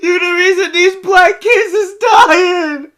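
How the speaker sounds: loud, shouted mock accusation. Mocking a commenter who scolds him, shouted. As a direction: yell it back as a mock lecture. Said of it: "he over here talking about".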